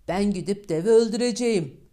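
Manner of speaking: loud, determined vow; bold declaration. Acted. Eldest son loudly vowing to his father to kill the giant. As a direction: announce it boldly to your father.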